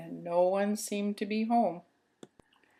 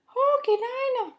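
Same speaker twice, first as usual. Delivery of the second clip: very high, louder squeak of alarm; squeaky baby mouse; 6 dB louder than usual. Acted. Baby Mouse finds his broken chair, very high squeak.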